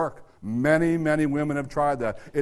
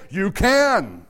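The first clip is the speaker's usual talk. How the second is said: You can called out loud and high; rising to a firm loud declaration; emphatic you can; 7 semitones higher, 6 dB louder than usual. Shown as a demonstration.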